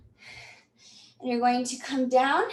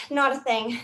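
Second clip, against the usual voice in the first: normal talking voice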